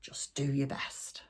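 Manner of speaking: soft breathy whisper; whispered quote. Retold or imitated. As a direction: whisper it like a shrug. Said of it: "I'm gonna say to Andrea".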